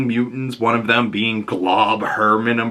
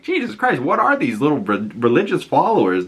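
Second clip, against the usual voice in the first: shocked disbelief; 5 semitones higher than usual. Retold or imitated. Viewer gasps at cultists ready to die, shocked and much higher. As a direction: yelp of shock that jumps much higher, then settles. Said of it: "and I was like".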